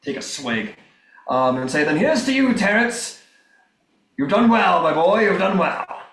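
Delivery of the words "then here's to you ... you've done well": hearty raised toast to a comrade; high, emphatic cheers over drinks; aggressive toast. Acted. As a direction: toast them forcefully, pitched up. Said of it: "cheers you rather aggressively".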